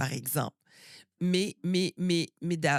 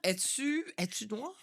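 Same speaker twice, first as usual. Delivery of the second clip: higher-pitched, softer, tentative question; puzzled questioning; 4 semitones higher, 4 dB quieter than usual. Retold or imitated. Guest voices people guessing her origins, puzzled questioning. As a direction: imitate someone unsure what to ask.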